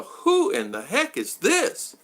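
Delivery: high, slow who in the heck; wife baffled by a stranger's message; mock baffled outrage. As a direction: drag it out in disbelief.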